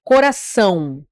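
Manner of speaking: coração with the final ção punched; stress on last syllable. Shown as a demonstration. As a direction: lean hard on ção at the end of coração. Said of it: "Qual é a última sílaba? São".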